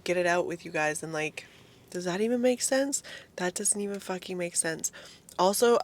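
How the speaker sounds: ordinary talk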